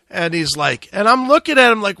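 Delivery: ordinary talk, nothing marked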